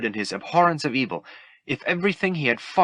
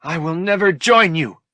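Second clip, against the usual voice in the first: higher, louder defiant cry; defiant refusal; 8 semitones higher, 7 dB louder than usual. Acted. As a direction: spit out the refusal. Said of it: "Luke refused to give in".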